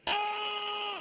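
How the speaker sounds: high shouted "Ah!" like a horn blast; pedestrian's horn. Retold or imitated. Comic horn-blast at a Starbucks customer saying get. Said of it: "Can I get a coffee".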